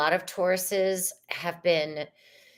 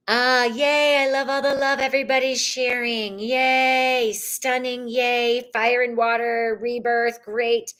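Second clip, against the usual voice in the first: higher, bright live-stream cheer; excited chat reading; 6 semitones higher than usual. Real, not acted. Host reading the live chat, excited and higher. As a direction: cheer the comments on, quick and high.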